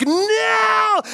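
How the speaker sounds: very high squealed no; cartoon seal shrieking as the shark hits; seal's terrified cry. Seal's face as shark strikes.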